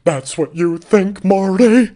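big Broadway delivery, slowed on the middle; Laura Linney impression. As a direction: overdramatic stage voice calling Marty. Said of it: "Laura Linney really ups the dra".

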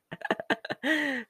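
high, breathy burst of laughter; a quick real laugh. Real, not acted. Laughs at own joke.

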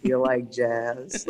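drawn-out, very slow "You like jazz?"; slow meme quote. Retold or imitated. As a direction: stretch it out like the meme. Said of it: "'Cause of the meme".

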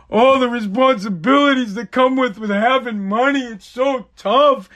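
slow, whining mock of a rich complainer; whiny rich person. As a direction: drag it out like a pampered moaner.

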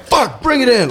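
high-pitched burst of frustration; acted-out fed-up yell; retold exasperated order. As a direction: re-enact snapping an order at the crew. Retells angry order to crew.

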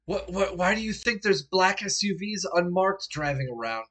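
exasperated, high and loud rebuke; incredulous. Acted. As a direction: stammer into a disbelieving question. Jack incredulous at player's question.

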